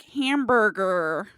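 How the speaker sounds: very slow, drawn-out fake American accent; mock American accent. Mocking the actress's fake American accent, slow and drawn out. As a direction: stretch "Hamburger." like a bad American accent. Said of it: "That's a fake American accent".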